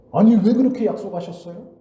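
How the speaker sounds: loud, high-pitched protest in the people's voice; resentful complaint. Retold or imitated. People complaining to their leaders over the oath, resentful protest. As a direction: imitate an indignant crowd demanding an explanation. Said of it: "이렇게 원망했다는 거예요".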